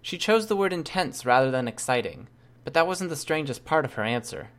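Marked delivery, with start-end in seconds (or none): none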